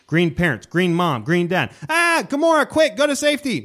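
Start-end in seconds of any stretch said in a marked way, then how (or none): 1.9-3.6 much higher, louder panicky parent voice; mock alarm, pitched way up; frantic parent. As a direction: yelp it like a hammed-up scared dad. Parents voiced in retold scene.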